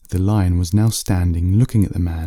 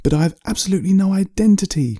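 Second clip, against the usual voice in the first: much higher, anguished plea; crying out in pleading; 12 semitones higher, faster than usual. Acted. Man pleading with the lion for information, anguished. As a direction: cry out that you are nobody. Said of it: "cried out the man, pleading".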